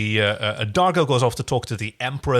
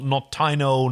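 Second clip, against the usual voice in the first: ordinary talk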